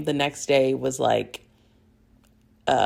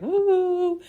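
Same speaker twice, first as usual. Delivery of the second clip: excited whoop; 14 semitones higher than usual. Retold or imitated. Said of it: "He's like".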